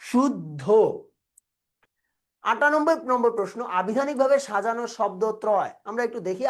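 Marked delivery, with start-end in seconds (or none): 0.0-0.6 deliberate "শুদ্ধ" with a held double d; pronunciation demo. Shown as a demonstration. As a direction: model "শুদ্ধ" as shud-dho. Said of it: "শুদ্ধ শব্দের উচ্চারণ হইল এটা".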